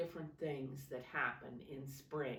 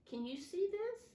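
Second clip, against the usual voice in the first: ordinary delivery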